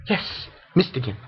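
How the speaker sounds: usual speaking voice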